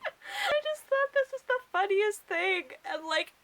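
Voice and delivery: real helpless laughter; laughing fit. Real, not acted. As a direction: squeal it out while cracking up. Laughing at allergic scene.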